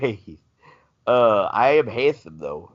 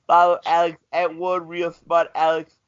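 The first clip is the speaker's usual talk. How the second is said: ordinary talk, nothing marked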